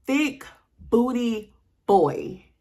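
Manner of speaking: words stretched out slowly; slow drawn-out reading. Retold or imitated. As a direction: drag out each word in disbelief. Reads the profile name aloud.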